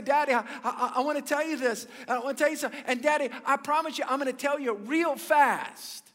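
put-on high, rushed child's voice, pleading; a young daughter hurrying to get her words out; hurried little girl. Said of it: "she began to hurriedly say".

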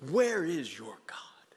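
slow, breathy whispered mocker's question; whispered taunt. Mocker taunting the struggling believer, whispered sneer. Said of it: "Mockers, yes".

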